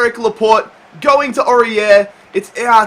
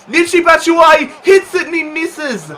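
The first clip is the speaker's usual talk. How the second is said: shouts it higher and louder as the chance goes; yelling at a big chance; excited shout; 10 semitones higher, 4 dB louder than usual. Real, not acted. Gamer shouting at his striker's near miss, excited.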